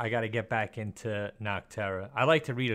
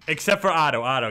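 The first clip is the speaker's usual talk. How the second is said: sudden loud shout, much louder; calling out; 5 semitones higher, 11 dB louder than usual. Real, not acted. Host jokingly exempts Otto from unsubscribing, calling out loudly. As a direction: shout the exception out over everyone.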